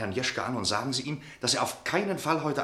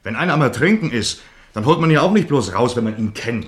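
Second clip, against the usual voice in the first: louder, urgent pleading; heated appeal; 8 dB louder than usual. Acted. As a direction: push it hard, he's desperate they act. Aufgebrachter Appell an die Stammgäste. (eindringlich und lauter)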